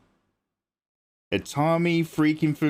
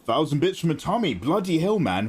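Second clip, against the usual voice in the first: ordinary talk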